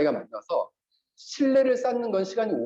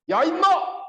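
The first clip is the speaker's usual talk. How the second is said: higher, louder mock yell; rude yell; 10 semitones higher, 4 dB louder, slower than usual. Pastor imitates someone swearing at another, mock yelling. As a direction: bark it like a street insult. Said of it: "이렇게 욕을 했다".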